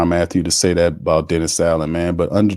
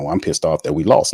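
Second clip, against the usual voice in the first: normal talking voice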